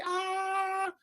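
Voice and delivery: high squealing "Ah!" of thrill; excited gasp. Retold or imitated. New car owner squeals with joy, high excited gasp. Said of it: "You're like".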